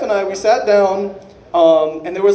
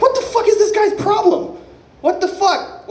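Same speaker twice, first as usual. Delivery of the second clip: high, outraged mock yell; outraged high-pitched disbelief; pissed-off wife. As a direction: play the furious wife, pitched way up. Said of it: "my wife immediately was pissed off".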